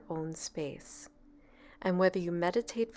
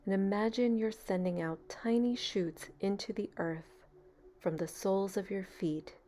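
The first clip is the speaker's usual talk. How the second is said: calm, even meditation voice with long pauses; guided meditation. Real, not acted. Meditation leader easing a beginner into relaxation, calm and unhurried. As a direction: guide them gently into stillness, leaving space between phrases.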